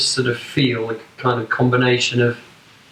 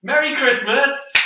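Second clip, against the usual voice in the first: bright, high festive call; cheery shout; 11 semitones higher, slower than usual. Real, not acted.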